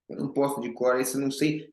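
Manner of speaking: ordinary talk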